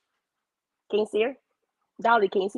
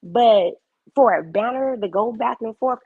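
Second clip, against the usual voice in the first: ordinary talk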